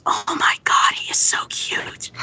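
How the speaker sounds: breathy aside whispered to an assistant; retold whisper. Star whispering to her assistant about the shy boy, voiceless whisper.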